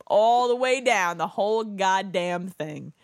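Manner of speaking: drawn out and loud; slow and emphatic. Real, not acted. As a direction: slowly egg them on. Urging a beer chug.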